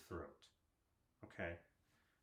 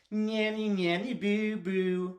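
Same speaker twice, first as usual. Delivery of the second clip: high, loud playground singsong; singsong taunt; 9 semitones higher, 10 dB louder, much slower than usual. Shown as a demonstration. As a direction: tease like a kid in the schoolyard. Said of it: "Do you hear mine".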